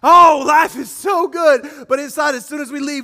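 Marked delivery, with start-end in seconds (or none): 0.0-1.8 loud slow mock delight; fake cheerful shout. Churchgoer faking joy at church, loud put-on cheer. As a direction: oversell how good life is.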